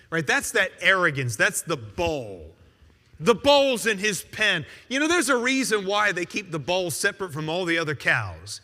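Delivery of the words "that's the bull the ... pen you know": normal talking voice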